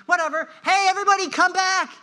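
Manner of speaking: high, full-voiced shout to a crowd; calling out. Father too overjoyed to hear the apology, shouting to everybody. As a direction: holler for everyone to come back.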